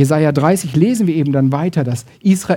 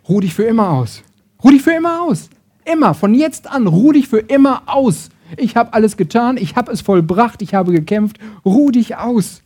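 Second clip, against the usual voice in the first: high, rising preacher's declaration; emphatic proclamation; 5 semitones higher, 3 dB louder than usual. Acted. Grace tells the weary to rest forever, emphatic proclamation. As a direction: proclaim lasting rest with full conviction. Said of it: "Die Gnade sagt".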